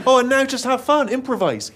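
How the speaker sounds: high, loud mock-breezy instruction; imitated director. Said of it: "and then he says".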